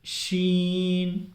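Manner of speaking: शीन drawn out slowly with a strong sh hiss; sharp sheen. Shown as a demonstration. Teacher to viewers, a long hissing शीन. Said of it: "थोड़ा ज़ोर सा डाल करके".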